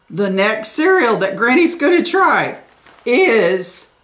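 ordinary talk

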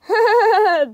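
loud real laugh; burst of laughter. Real, not acted. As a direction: crack up at the joke. Laughs at the funny book line.

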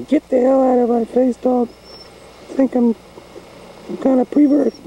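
very high, squeaky animal voice; pretend pet voice. Pets quarrel nose to nose, high cartoon voice. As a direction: voice a bickering pet in falsetto.

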